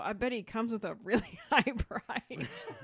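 breaks into a laugh near the end; amused retelling of a doubtful boss. Retold or imitated. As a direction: let a laugh spill in on price. Laughs mid-quote.

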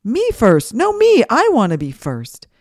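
loud, high pushy shouts; shouting kids. A narrator's reading. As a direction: yell it like squabbling kids shoving to the front. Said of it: "They shouted".

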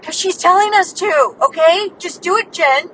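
loud, high mock urgency; imitated insistent sleep-talker. Retelling partner's sleep-talk demands, loud imitated insistence. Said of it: "And you're like".

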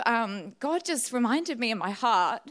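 ordinary talk

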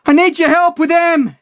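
high-pitched desperate shout for help; fearful yell. A narrator's reading. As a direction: plead at full voice across a void. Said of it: "I could hear the fear in my voice".

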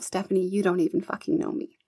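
ordinary talk, nothing marked

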